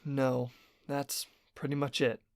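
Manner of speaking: soft, halting reply; subdued backing down. Acted. Tyler giving up his objection to the boss, subdued. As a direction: give in softly, under the boss's eye. Employee backs down.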